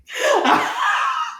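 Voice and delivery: very high squealing laugh; burst of laughter. Real, not acted. Cracking up at a statue having pride, squealing laugh. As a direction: shriek with laughter.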